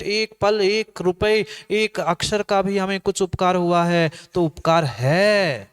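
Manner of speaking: high, pressing sermon voice, slowing on the list; fervent preaching. Acted. Preacher urges the congregation to value small kindnesses, fervent. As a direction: drive the point home, one item at a time.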